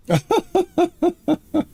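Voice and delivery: high, breathy relieved giggle. Real, not acted. Streamer giddy after finding ship, high giggle.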